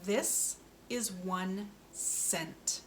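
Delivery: "This is one cent" said very slowly, each word apart; slow model sentence. Shown as a demonstration. Speech therapist models a sentence for a child, slow and careful. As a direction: spell it out slowly for the learner. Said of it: "And our sentence is".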